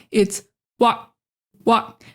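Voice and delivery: "wa" and "Walk" with the air stopped sharply; abrupt cut-off. Shown as a demonstration. Teacher demonstrating the abrupt stop. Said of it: "cut off the air in an abrupt way".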